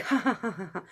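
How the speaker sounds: mock "ha ha" laughter; imitated laugh. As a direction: imitate a man laughing along. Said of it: "what is he laughing at".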